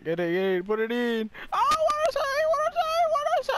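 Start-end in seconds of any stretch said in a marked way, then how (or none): 1.5-3.6 very high falsetto jeering; squealed mock praise. Gamer mocking chat praise, squealed mock praise. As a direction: squeal it over and over, hammed-up.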